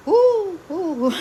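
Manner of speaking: high, loud whoop; loud woo. Real, not acted. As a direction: cheer as the ride drops. Cheering on the ride.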